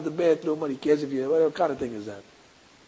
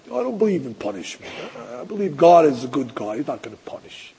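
mocking modern believer; 6 semitones lower than usual. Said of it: "You have modern people like that".

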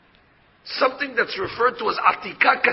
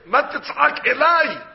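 raised, loud and slow, landing on "elai"; God addressing Moses; 6 semitones higher, 5 dB louder, slower than usual. Acted. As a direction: proclaim it emphatically, weighting "elai". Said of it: "So God tells Moshe".

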